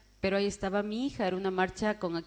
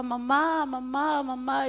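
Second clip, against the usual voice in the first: retold scream; 4 semitones higher, slower than usual. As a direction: call out for mother in a mild mock scream.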